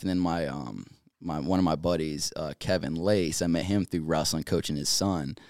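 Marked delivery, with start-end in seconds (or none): none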